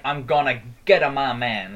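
an extra "a" slipped in between "get" and "my". Retold or imitated. Imitating the cowboy stranger, extra "a" before "my". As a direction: say "get a my man", adding an "uh" after "get". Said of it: "like, the, add the A".

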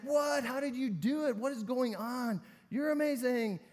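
high, loud burst of amazed disbelief; astonished crowd. Acted. Crowd hears the scrawny guy's winning count, loud high astonishment. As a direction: exclaim it like a flabbergasted onlooker.

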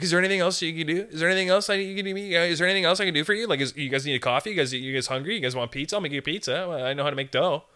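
anxious overeager pitch; nervous rambling. Retold or imitated. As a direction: gush like a desperate applicant. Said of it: "nervous or distressed".

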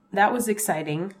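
ordinary delivery

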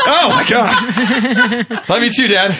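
high, laughing groan of relief; exasperated laugh. Retold or imitated. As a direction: drag out "Oh, my God" laughing. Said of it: "And I'm like".

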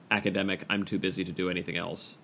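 usual speaking voice

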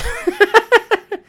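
high, loud laugh at himself; real burst of laughter. Real, not acted. Laughing at what he told the store. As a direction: cracks up at his own line.

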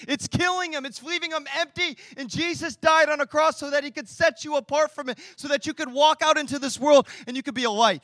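loud, driving sermon delivery; full-force preaching. Acted. Pastor urging the congregation, loud full-force preaching. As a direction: preach it to the back row.

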